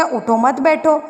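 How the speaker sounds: اٹھو مت بیٹھو as one unbroken phrase; no pause after اٹھو. Shown as a demonstration. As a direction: run اٹھو directly into مت بیٹھو. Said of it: "اگر نہ ٹھہرا جائے تو لفظ کیا بن جائے گا".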